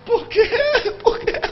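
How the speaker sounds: high-pitched hysterical wail, imitated; mock tearful high cry; crying, shrieking woman. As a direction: mimic a hysterical woman wailing. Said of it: "Ela tava chorando e gritando".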